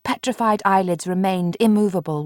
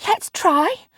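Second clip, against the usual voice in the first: much higher eager plea; pleading; 11 semitones higher, 4 dB louder than usual. A narrator's reading. Marianne pleading with Mark, much higher. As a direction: beg him to try. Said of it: "Marianne pleaded".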